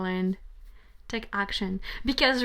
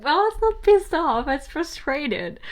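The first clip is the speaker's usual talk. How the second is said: frustrated; 5 semitones higher, 10 dB louder than usual. Real, not acted. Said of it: "I'm frustrated right now".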